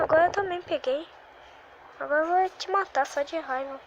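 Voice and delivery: ordinary talk, nothing marked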